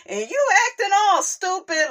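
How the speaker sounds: high, fast, squealing mock outrage; mock outraged Letitia. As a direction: voice her snapping at him, way up high. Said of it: "She like".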